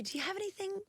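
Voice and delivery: timid request at the bar. Retold or imitated. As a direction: ask hopefully, a bit quickly, like begging the bartender. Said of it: "And I would say".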